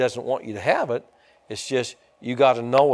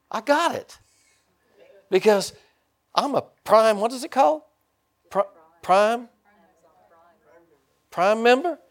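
dawning excitement; 10 semitones higher than usual. Retold or imitated. Discovering he has Prime.

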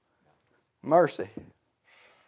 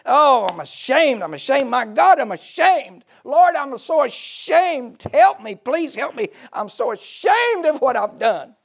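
rising, pleading cry of shame, louder and higher; anguished confession; 8 semitones higher, 5 dB louder than usual. Acted. Preacher voicing the repentant sinner, anguished pleading cry. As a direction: beg God like a man broken by shame.

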